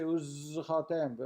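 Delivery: Uzchartem slowly, with a drawn-out buzzing z; meticulous zayin. Shown as a demonstration. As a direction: linger on the z of Uzchartem. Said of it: "sometimes people are meticulously say it".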